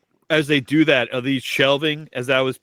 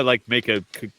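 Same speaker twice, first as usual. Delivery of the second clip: normal talking voice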